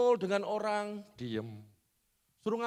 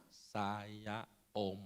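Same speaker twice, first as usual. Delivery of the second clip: "Saya om" said slowly, softer and lower, each word clear; slow and easy; 10 semitones lower, 8 dB quieter than usual. Retold or imitated. Pastor showing his son speaking slowly. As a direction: ease out "Saya om" slowly and gently. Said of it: "kalau ngomong pelan, enak".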